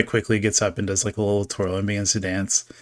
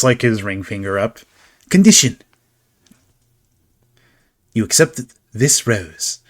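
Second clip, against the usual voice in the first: louder, grand offer with a sharp Condition; theatrical showman; 5 dB louder than usual. Acted. Carnival figure offering the rose, lightly grand. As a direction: lay down the bargain with flourish.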